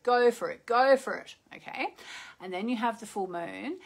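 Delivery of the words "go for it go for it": punchy, louder urging. Shown as a demonstration. Host illustrating the waxing moon's push, urging. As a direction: drive each repeat forward with energy.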